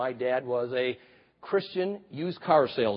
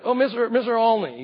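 put-on stuttering old man; 7 semitones higher, 6 dB louder than usual. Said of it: "he stuttered".

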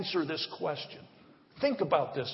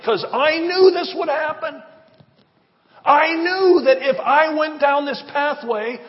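exasperated; 10 semitones higher, 11 dB louder than usual. Real, not acted. Exasperated confession.

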